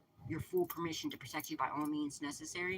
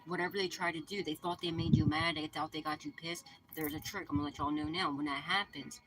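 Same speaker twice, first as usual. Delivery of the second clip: ordinary talk, nothing marked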